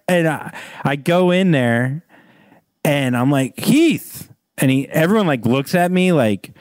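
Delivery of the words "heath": high-pitched retold call of a name. Fan hailing the actor in a bar, retold high call. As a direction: call it out to get his attention. Calls to star across bar.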